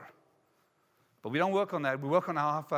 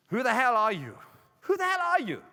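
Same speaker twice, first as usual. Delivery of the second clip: high-pitched accusing demand; mock indignant challenge; 5 semitones higher than usual. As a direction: throw the challenge out, pitch leaping up. Repeats old man's challenge, pitched up.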